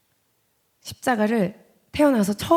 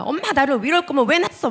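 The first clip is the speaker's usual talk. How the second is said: put-on a child crying out at a parent, higher and louder; imitated child's outburst; 6 semitones higher, 5 dB louder than usual. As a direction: yell it like a hurt kid blaming mom.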